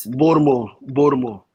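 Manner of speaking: clipped local Baltimore pronunciation, "Bawlmer"; Baltimore accent. Shown as a demonstration. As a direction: say it like a Baltimore native. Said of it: "That's how they said".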